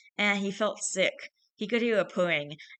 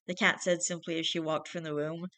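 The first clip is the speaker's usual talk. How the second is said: plain reading voice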